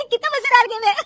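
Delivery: high, fast giggly exclaim; laughing delight. Acted. Daughter-in-law giggling at the TV show, delighted. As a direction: gush through giggles.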